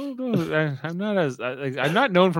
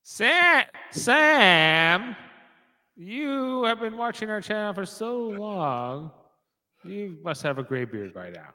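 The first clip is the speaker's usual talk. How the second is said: zing voice; 5 semitones higher, much slower than usual. Pretend. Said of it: "zing voice".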